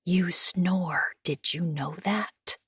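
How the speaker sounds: soft hushed whisper into his ear; whispered teasing. Acted. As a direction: breathe it right at his ear, slowing at the end. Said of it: "I lean close to his ear and whisper".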